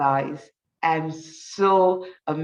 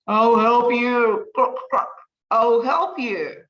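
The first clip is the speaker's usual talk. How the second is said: croaking frog; 4 semitones higher, 4 dB louder than usual. Acted. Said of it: "and croaks".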